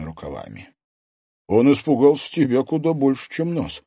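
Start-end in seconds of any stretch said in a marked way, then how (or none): 1.5-3.8 raised, puzzled remark; higher, surprised young man. A narrator's reading. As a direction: say it in astonishment. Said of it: "удивлённо произнёс Матвей".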